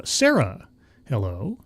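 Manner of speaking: usual speaking voice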